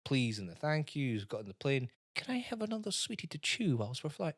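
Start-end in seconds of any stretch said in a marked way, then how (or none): 2.1-4.3 lightly voiced little girl, higher; a hint of a polite wee girl asking for a sweetie; sweet child voice. Kid asking on the plane.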